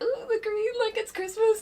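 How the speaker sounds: high delighted ooh; excited squeal. Retold or imitated. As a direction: squeal like a thrilled kid. Retelling own delighted thought.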